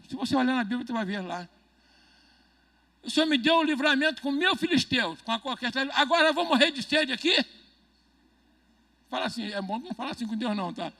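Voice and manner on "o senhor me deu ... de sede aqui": reclamação alta e indignada, meio rindo. Retold or imitated. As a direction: cobre de Deus, incrédulo e exaltado. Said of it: "Olha como é que ele fala com Deus". (Sansão, com sede, reclamando alto com Deus, indignado)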